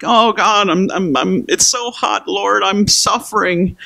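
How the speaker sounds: high, slow complaining whine; whining Jonah. Retold or imitated. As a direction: moan like a prophet melting in the heat. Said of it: "'cause Jonah's like".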